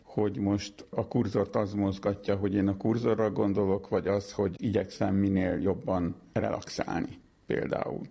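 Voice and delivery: ordinary talk, nothing marked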